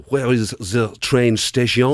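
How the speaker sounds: low, slow put-on French accent; mock French accent. Irish speaker catches himself asking foreigners directions, mock French accent. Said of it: "put on a French accent".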